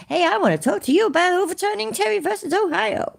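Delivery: high-pitched mock American accent. Imagined approach to the neighbours, in a put-on American accent. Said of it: "That was my best American accent".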